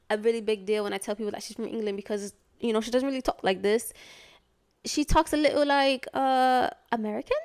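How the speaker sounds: a clumsy, hammed-up British accent; bad British accent. Shown as a demonstration. Mocking her mom's English accent for viewers, bad British accent. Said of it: "That was the worst British accent".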